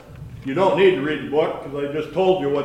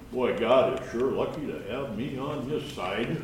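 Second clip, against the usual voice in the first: soft, dry mock admiration; understated sarcasm in a quieter voice; sarcastic; 3 semitones lower, 7 dB quieter than usual. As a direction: quote it sarcastically, voice dropped. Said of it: "sarcastically, of course. He would say".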